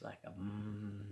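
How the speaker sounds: low, soft sustained hum; humming noise. Retold or imitated. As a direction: imitate a craft's steady hum. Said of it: "humming noise".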